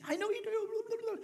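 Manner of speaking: very high, drawn-out mock mum voice; high-pitched Irish mum. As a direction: imitate mum swooping way up high. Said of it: "she talks way up high and way down low".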